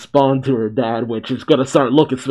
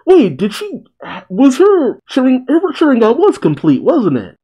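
excited confusion; 12 semitones higher, 3 dB louder than usual. Real, not acted. Excited confusion about the eyes.

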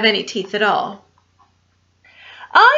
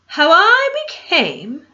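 dramatic title reveal; 4 semitones higher than usual. Acted.

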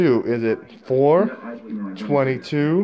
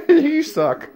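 mock taunt; 12 semitones higher than usual. Host insults contestant.

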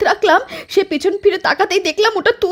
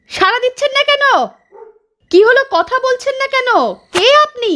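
impatient call, higher; 6 semitones higher than usual. Acted. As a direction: demand an answer from a silent stranger. Wife calling stranger in field.

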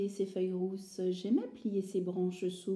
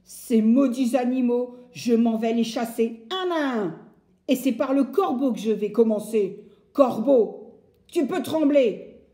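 threatening woodcutter; 4 semitones higher, 9 dB louder than usual. Acted. Bûcheron menace les animaux.

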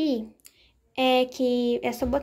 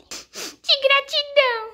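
put-on high, slow emotional child; high, slow weepy kid; slow tearful kid; 12 semitones higher, 4 dB louder, much slower than usual. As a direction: draw it out, moved to tears. Doll tearful thanks.

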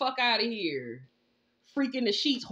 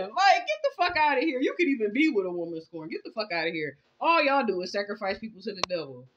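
normal talking voice

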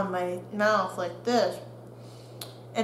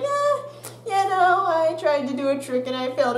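breezy, offhand brag, higher pitch; casual shrug; 6 semitones higher than usual. Retold or imitated. Kid shrugging off broken tooth to classmates, breezy. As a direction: toss it off like it's nothing. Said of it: "I'm just like".

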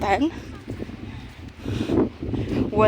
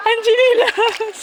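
laughing; 12 semitones higher, slower than usual. Real, not acted. Laughing at the slippers.

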